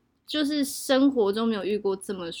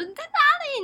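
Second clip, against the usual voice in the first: much higher, urgent pitch; agitated question. Retold or imitated. Answering a friend's call in a panic, agitated high question. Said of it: "我就非常激动接".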